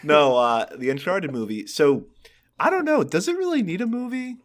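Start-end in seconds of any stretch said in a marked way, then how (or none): none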